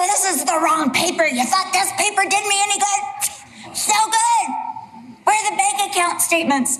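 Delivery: high-pitched judge tearing into a litigant; Judge Judy. Acted. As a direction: berate them over bad evidence. Acted scolding by Judge Judy.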